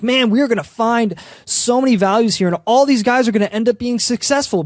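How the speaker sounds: mock optimism. Voicing hopeful drafters expecting to find so many values, mock optimism.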